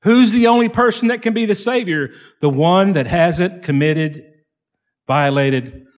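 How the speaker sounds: loud, high preaching; preaching at full force. Acted. Preacher tells the congregation they need a Savior, loudly. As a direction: drive the point home loudly.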